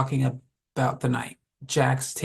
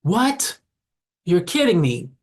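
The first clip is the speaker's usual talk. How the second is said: high, rising, louder cry of surprise; shocked disbelief; 5 semitones higher, 4 dB louder, slower than usual. A narrator's reading. Ronald finds his friends behind him laughing, shocked disbelief. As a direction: pitch leaps up, incredulous. Victim learns it was a prank.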